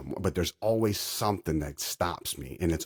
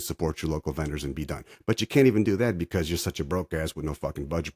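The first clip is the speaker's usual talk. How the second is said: normal talking voice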